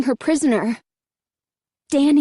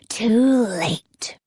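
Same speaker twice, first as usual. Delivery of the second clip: sneering; 3 semitones lower than usual. A narrator's reading. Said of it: "he sneered".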